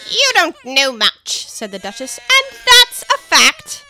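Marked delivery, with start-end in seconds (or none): 0.1-1.5 loud, slow, haughty duchess; imperious plummy grande dame. Acted. Duchess voice held. 2.2-3.8 loud, slow, haughty duchess; imperious plummy grande dame. Acted. Duchess voice held.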